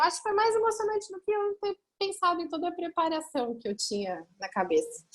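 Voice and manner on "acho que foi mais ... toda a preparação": much higher, tearful strained voice; getting choked up. Real, not acted. Mother recalling the pregnancy news, really choking up. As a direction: push through as the emotion rises. Moved by memory, cries soon after.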